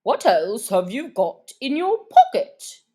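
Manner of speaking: pompous bird voice; stately old bird asking; dodo voice. Acted. Dodo character voice.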